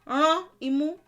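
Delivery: Ránmú said high with the nose squeezed; squeezed-nose demo. Shown as a demonstration. Teacher showing students how to squeeze the nose.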